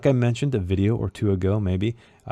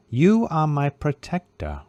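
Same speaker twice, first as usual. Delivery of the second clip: slow, higher, sharp snap at his protector; snapping Herlock; 6 semitones higher than usual. A narrator's reading. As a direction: snap it at him, slow and pointed. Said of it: "he snaps".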